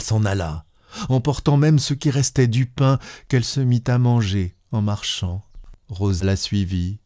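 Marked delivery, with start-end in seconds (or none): none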